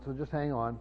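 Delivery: normal talking voice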